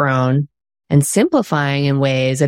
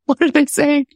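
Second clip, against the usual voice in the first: high, worried wondering voice; anxious wondering; 11 semitones higher than usual. Retold or imitated. New author wondering about readers, high and anxious. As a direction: pitch it up like a nervous new author. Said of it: "So at first you go".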